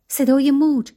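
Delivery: usual speaking voice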